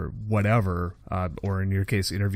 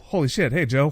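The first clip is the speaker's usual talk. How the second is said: higher, laughing starstruck hello; starstruck greeting; 6 semitones higher, 4 dB louder than usual. Retold or imitated. Meeting Joe at the venue, laughing starstruck hello. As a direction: gush nervously at your idol.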